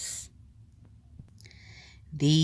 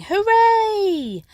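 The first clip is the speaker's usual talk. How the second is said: very high, loud joyful cheer; 8 dB louder than usual. A narrator's reading. Villagers celebrating the whale's escape, high loud cheer. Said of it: "villagers shouting".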